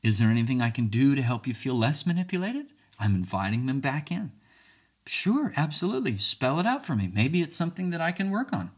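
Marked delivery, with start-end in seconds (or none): 5.0-8.7 high, open-minded agreement; accommodating. Acted. Speaker invites the accuser back in, accommodating. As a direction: lift the pitch and invite more feedback.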